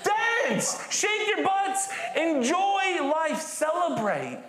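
very high excited exhortation; rally them to dance; high bursting encouragement; exuberant call. Real, not acted. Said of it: "urging the church to celebrate".

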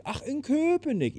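hohes, langsames, überraschtes „Köpenick“. Retold or imitated. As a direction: staun über „Köpenick“, hoch und langsam. Sängerin überrascht. (Sängerin hört überrascht, dass er in Köpenick wohnt)

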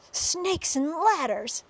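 high, drawn-out cry of dismay; yelling. A narrator's reading. Head of fire department sees the spider, dismayed yell. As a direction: yell it as a shocked oath. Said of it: "yelled the head of the fire department".

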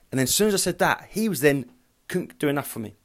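ordinary talk

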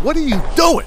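high, baffled what are you doing; rising shocked squeak at the stranger; incredulous. Retold or imitated. To the guy pacing outside his stall, high incredulous call. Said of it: "I'm like".

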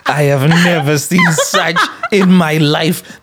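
high laughing disbelief. Real, not acted. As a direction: talk through real laughter. Guest forgets name's meaning.

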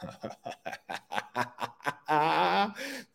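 drawn-out sinister cackle; evil villain laugh. Acted. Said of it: "There's my evil villain".

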